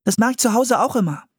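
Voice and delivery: quick, high and eager; enthusiastic. A narrator's reading. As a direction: chip in fast, high-pitched and keen. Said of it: "sagte Anne begeistert". (begeistert, schnell und hoch)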